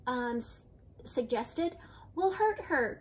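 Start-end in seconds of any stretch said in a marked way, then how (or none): 2.1-3.0 a higher, mildly worried cry; a small anxious protest, voiced lightly. A narrator's reading. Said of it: "cried a fourth leprechaun".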